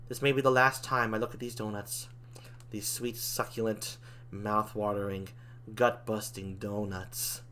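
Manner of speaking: low, soft, slow savouring; wistful farewell. Acted. Demon looking at donuts maybe for the last time, wistful and hushed. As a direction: linger softly over each adjective.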